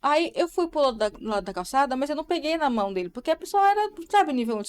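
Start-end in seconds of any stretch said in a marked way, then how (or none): none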